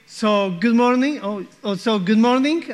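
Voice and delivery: normal talking voice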